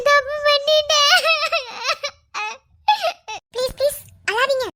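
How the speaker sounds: high, loud, tearful outburst; crying out. Acted. Wife breaks down at the clinic, loud and tearful. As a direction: let it burst out through sobs.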